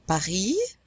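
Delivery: "Paris" as French pa-REE, throaty R, rising end; silent final S. Shown as a demonstration. Teacher quizzes learners, French "Paris" pa-REE. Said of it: "Are we gonna pronounce this S".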